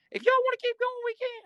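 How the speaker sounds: very high, strained whispery squeak, pushed loud; Whisper. Retold or imitated. Big inmate breaking up a fight, imitated high strained voice. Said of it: "He talked like this".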